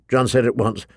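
ordinary delivery, nothing marked

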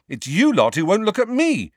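voiced with real heat; angry. A narrator's reading. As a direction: throw it back at them angrily. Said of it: "said Harry angrily".

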